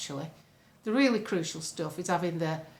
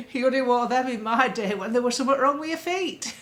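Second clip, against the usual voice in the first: broad Yorkshire uncle, laughter in the voice; 3 semitones higher, 4 dB louder than usual. Retold or imitated. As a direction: tease in thick Yorkshire, barely holding back laughter. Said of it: "I'll use my Uncle Bill".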